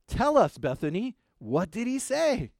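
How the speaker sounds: lilting, encouraging classroom question; lightly voiced teacher. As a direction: lightly play a teacher coaxing out the answer. Acts out the teacher urging Bethany.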